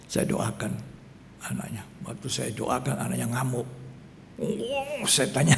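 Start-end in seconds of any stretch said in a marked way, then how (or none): none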